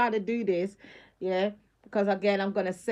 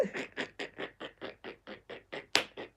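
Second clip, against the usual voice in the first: silent laugh; 11 dB quieter than usual. Real, not acted. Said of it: "I really can't stop laughing".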